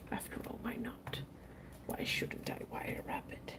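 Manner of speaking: sly whispered aside; secretive whisper to herself. Pretend. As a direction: whisper it to yourself, conspiratorial.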